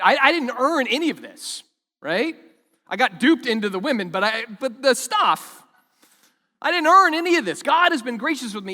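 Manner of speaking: loud, high, building declaration; fervent preaching. Acted. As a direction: preach it with full force. Preacher voicing Jacob's gratitude.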